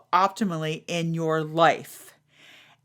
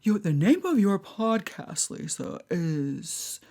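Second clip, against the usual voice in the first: lightly voiced scandalized churchgoer, fading to a whisper; mock the prim scold, softer and softer; half-hearted pearl-clutching whisper; 4 dB quieter than usual. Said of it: "That's how they talk".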